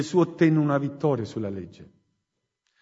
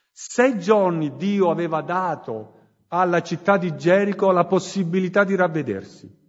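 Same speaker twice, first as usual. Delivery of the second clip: fiery preaching; 3 semitones higher than usual. Acted. Preacher urging the congregation to repent, full-force preaching. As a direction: preach it out to the whole church.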